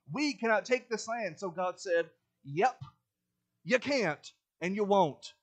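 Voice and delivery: loud, high-pitched, driving sermon delivery; forceful preaching. Real, not acted. Preacher urging the congregation to learn from Israel, forceful preaching. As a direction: preach at full force, hammering each lesson.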